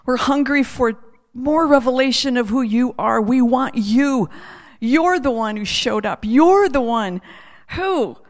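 heartfelt prayer with repeated falling cadences; earnest prayer. Real, not acted. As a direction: pray aloud, building through each repeated declaration, then easing.

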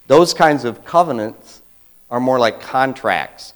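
ordinary talk

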